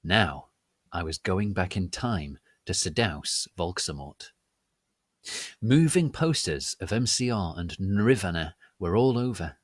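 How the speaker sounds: ordinary delivery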